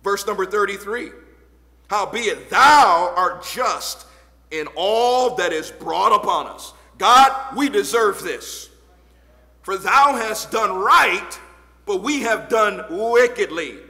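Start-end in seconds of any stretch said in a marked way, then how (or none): 1.9-13.8 louder, slow scripture reading at full force; preaching scripture. Acted. Preacher booming scripture to the congregation. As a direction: proclaim each phrase of the verse.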